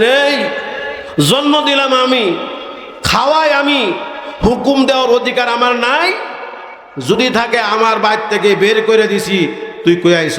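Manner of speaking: high, heated scolding; father scolding his son for leaving home, high and heated; angry father. Retold or imitated. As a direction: berate the runaway son.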